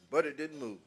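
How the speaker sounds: normal talking voice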